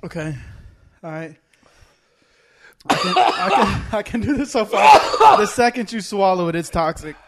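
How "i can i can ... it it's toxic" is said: laughing. Real, not acted. Laughing through the burn.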